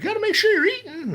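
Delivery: very high, put-on voice of a fussing relative; caring relatives. Host imitates family urging him to eat, high fussing voice. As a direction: mimic a worried auntie, high and sing-song. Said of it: "everyone's like".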